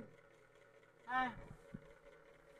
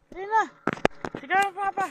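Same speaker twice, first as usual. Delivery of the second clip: high, louder playful urging; mock eager call to wake Papa; 6 semitones higher, 9 dB louder, much faster than usual. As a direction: egg the baby on to shout. Urging baby to wake dad.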